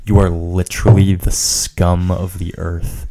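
slow, very loud denunciation; hammed-up booming insult, every word drawn out; over the top. Friend mock-insulting a friend for fun, over the top. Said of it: "that was over the top".

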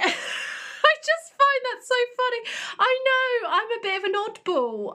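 high, amused; tickled by her own quirk; laughing. Real, not acted. Host laughs at her own oddball habit, high and amused.

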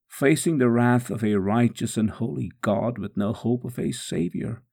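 usual speaking voice

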